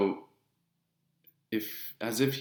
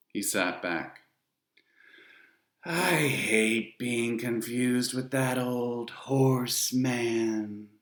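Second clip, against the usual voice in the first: Charon, silky disdain; 3 semitones higher, much slower than usual. A narrator's reading. Ferryman addressing dead children, slow and sneering. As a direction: savour the insult. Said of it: "He had a strange sound to him".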